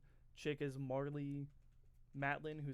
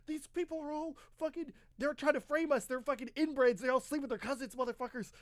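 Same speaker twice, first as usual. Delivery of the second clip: higher, louder hammed-up ranting; a friend ranting on the phone about the locals, in mock fury; mock angry rant; 10 semitones higher, 8 dB louder than usual. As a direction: vent like a furious friend on the phone. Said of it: "his friend, he goes".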